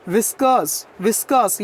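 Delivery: व्हिस्कर्स said with the r silent, ending in -kuhs. Shown as a demonstration. Teacher showing students the silent r, व्हिस्कर्स as "viskuhs". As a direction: drop the r in व्हिस्कर्स. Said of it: "आर silent है".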